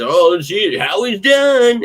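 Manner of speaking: drawn-out fake surprise, mocking them; mocking doubters.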